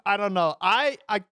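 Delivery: ordinary talk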